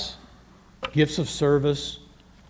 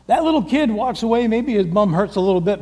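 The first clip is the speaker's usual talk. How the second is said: normal talking voice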